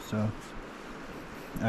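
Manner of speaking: talking through a mouthful of pizza; mouth full. Real, not acted. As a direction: mumble around a bite of food. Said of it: "don't talk with your mouth full".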